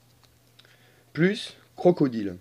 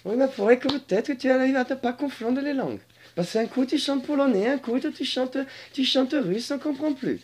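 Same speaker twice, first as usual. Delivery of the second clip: high-pitched creature complaining about a mix-up; comic dragon character; 10 semitones higher than usual. Acted. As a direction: scold in a high put-on character voice. High comic character scolds the singer.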